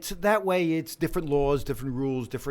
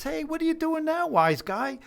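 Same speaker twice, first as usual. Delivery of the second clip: higher, teasing neighbor greeting; imitating the neighbor; 14 semitones higher than usual. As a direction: tease him like the neighbor did. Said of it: "uh, he says".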